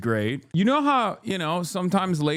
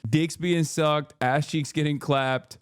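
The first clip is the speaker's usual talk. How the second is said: normal talking voice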